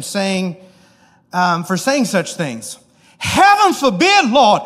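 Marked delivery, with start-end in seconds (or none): 3.2-4.6 high, loud, drawn-out Southern belle cry; hammed-up Southern belle outburst. Preacher playing Peter scolding Jesus, as a Southern belle. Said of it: "picture it like he's a Southern belle".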